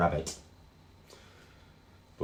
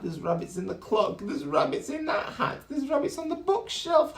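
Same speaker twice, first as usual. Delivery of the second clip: excited. Acted. Excited discovery of rabbits.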